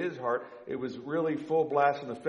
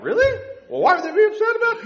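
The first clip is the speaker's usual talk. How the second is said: much higher and louder mock-surprised squeal; clueless husband; 11 dB louder, faster than usual. Pastor mocking husbands who miss family tension, high mock squeal. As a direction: play the oblivious husband, shocked and quick.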